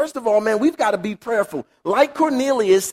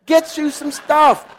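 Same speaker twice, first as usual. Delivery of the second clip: coaxing God voice; 6 semitones higher, slower than usual. Retold or imitated. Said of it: "God comes back to him again".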